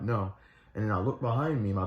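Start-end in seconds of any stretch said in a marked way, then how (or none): none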